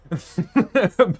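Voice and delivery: short, loud, high burst of laughter; sudden high giggle burst; amused laugh. Real, not acted. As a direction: crack up briefly at your own advice. Amused at own bad advice.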